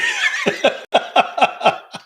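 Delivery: high, helpless giggling laugh; long high laughing fit; real burst of laughter. Real, not acted. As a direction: crack up mid-sentence in a high-pitched laugh. Cracks up at a listener's comment.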